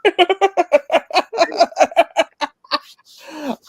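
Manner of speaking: high, loud laughter breaking up the words; too tickled to finish the sentence; real laughing. Real, not acted. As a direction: crack up at your own story. Cracking up at own story.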